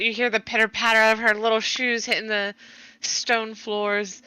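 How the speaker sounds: ordinary talk, nothing marked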